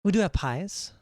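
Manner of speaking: a hint of a friendly waitress greeting customers; mild shop-girl politeness; polite waitress.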